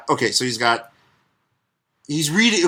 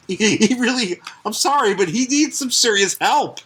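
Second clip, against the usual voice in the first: ordinary talk, nothing marked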